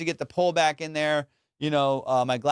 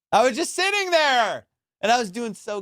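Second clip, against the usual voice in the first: exasperated wail; 7 semitones higher, 3 dB louder than usual. Retold or imitated. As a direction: wail it like a wronged man. Wailing over his own mishap.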